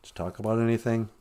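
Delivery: a deep-voiced partner checking on the tobacco. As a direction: ask like a gruff partner. Acted partner voice.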